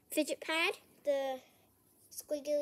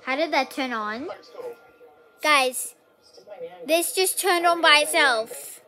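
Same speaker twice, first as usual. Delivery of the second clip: alarmed; 11 dB louder than usual. Real, not acted. Device turned on, alarmed.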